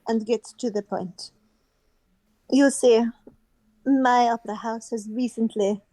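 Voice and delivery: put-on measured French accent with pauses. Opera house head explaining her trouble, French accent. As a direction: lay out business in a French accent. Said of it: "poor French accent".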